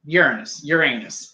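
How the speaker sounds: ordinary talk, nothing marked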